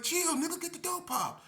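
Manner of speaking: trying to stall; nervous demand. Retold or imitated. To K P in the hallway, nervous high demand. Retold standoff.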